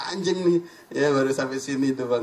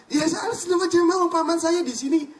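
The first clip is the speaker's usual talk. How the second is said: high and louder, the Kiai protesting he is orthodox; a Kiai bristling at the suggestion, pitched up; indignant insistence; 4 dB louder than usual. Retold or imitated. Kiai answers the preacher's doubt with high, loud indignation.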